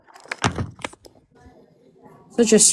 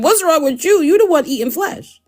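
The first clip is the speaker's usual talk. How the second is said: higher mock outrage turned back on him; mock indignant comeback; 10 semitones higher than usual. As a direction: throw it back at him, pitched up. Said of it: "I said".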